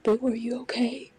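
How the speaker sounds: hushed, worried whisper from grandpa. Retold or imitated. Grandpa checks on scared child.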